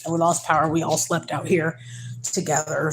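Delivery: normal talking voice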